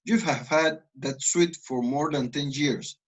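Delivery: "years" falling at the end; falling statement tune. Shown as a demonstration. Teacher showing the statement tune. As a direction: state it flatly, let it drop. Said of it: "Estoy afirmando".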